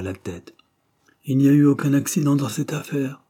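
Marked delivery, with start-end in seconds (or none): 1.2-3.2 firm and emphatic, louder; insistent. A narrator's reading. Detective insisting to the professor, firmly emphatic. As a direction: lean on every word, no room for doubt. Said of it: "dit-il avec insistance".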